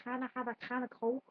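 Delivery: practice phrase in a light Liverpool accent; lightly Liverpudlian, throaty k sounds. Shown as a demonstration. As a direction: try out Scouse on a drill phrase. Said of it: "he gave us a phrase to say".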